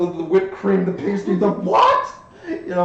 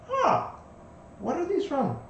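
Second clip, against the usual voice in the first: much higher, curious huh; surprised; 11 semitones higher than usual. Acted. GM voicing a guest asking about pastries, high surprise. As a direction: sound genuinely puzzled by the food.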